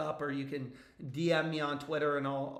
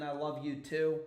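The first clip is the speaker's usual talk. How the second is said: ordinary talk, nothing marked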